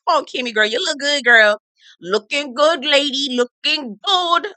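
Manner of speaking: slow, high sing-song praise; hyping her up. Real, not acted. Cheering a castmate's confessional look. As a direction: savour every word.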